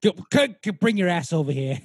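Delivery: hammed-up Boston tough guy; Mark Wahlberg. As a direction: summon him with mock menace in a Boston accent. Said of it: "It's me, it's fucking Mark Wahlberg".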